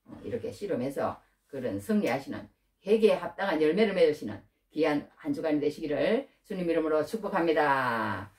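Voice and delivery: usual speaking voice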